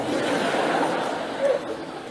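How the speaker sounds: airy laugh; breathy high laugh. Real, not acted. Laughing at his own airport story, real airy laugh.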